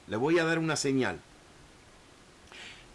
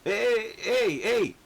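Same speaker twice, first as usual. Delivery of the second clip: higher, startled stammering excuse; flustered plea; 4 semitones higher, slower than usual. Retold or imitated. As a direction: stumble as if caught off guard. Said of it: "como que está diciendo".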